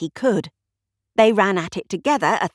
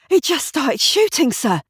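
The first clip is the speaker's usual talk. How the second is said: breathless, higher and louder; panting; 7 semitones higher, 3 dB louder than usual. A narrator's reading. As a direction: gasp it out after running. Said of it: "he panted".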